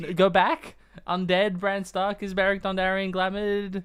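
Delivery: loud, high excited guess; shouting pictionary guesses. Real, not acted. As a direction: shout out the full theory.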